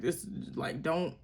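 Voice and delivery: ordinary talk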